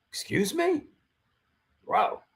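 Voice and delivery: incredulous. Retold or imitated. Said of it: "retelling his reply again".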